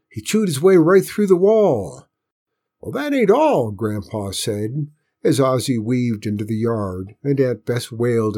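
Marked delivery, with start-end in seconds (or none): 0.1-2.1 high, loud amazed shout; excited yell. A narrator's reading. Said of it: "John Robert yelled". 2.8-3.7 grandpa voice. A narrator's reading. Grandpa voiced, raised pitch.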